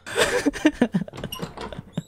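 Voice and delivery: scared airy giggle; nervous breathy laugh. Real, not acted. Nervous after hiding.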